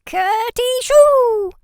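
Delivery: high, loud, drawn-out storybook sneeze; acted sneeze. A narrator's reading. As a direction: squeal out a big "curty shoo" sneeze. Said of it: "Peter sneezed".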